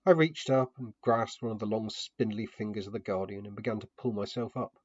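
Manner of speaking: plain reading voice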